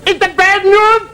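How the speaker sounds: high, slow mock German patient; German accent. German patient agreeing to lose his leg, comic accent. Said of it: "He said".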